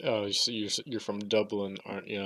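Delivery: quiet mock Irish accent. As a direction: softly fake an Irish lilt. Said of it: "in an Irish accent".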